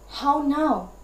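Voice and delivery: "how now" with both words stressed; spondee stress. Shown as a demonstration. Teacher giving an example of a spondee, both words of "how now" stressed. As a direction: give "how" and "now" a full beat each. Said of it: "two subsequent stressed".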